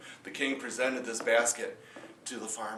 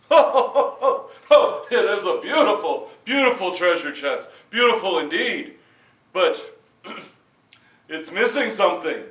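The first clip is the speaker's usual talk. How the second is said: loud, high, jolly king laughing; a gloating king, big and hearty; boisterous king delighting in treasure; booming king; 4 semitones higher, 8 dB louder than usual. Acted.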